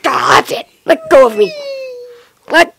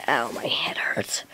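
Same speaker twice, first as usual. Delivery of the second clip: pained whisper; 15 dB quieter, slower than usual. Acted. Monster in pain.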